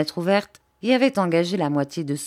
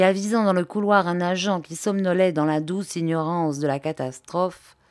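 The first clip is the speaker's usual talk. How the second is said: plain reading voice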